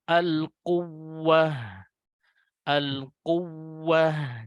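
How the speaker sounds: al-quwwah very slow, doubled wawu pressed; stress on the wawu. Shown as a demonstration. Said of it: "agak ada penekanan di huruf wawu".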